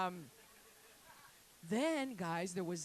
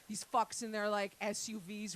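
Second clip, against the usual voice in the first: normal talking voice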